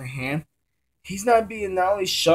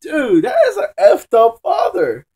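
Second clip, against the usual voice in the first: laughing outrage; 5 dB louder than usual. Retold or imitated. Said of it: "I'm like".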